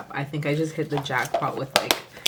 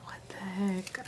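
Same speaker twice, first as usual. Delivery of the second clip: whisper; 2 semitones higher, 10 dB quieter than usual. Real, not acted. Stunned by foils.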